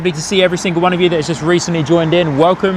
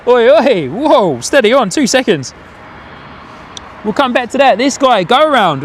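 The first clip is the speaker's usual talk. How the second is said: higher, animated reaction to a wobbling plane; excited calling out; 7 semitones higher than usual. Real, not acted. Spotter reacting on stream to an unsteady landing, excited. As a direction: react live, pitched up.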